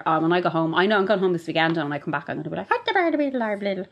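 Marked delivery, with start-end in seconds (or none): 2.7-3.8 high, hammed-up Northern Ireland accent; a put-on Northern Ireland twang. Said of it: "sound like you're trying to do a Nor-, Northern Ireland accent".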